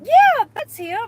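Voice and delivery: high, loud eager agreement; eager yes. Retold or imitated. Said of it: "And I'm like".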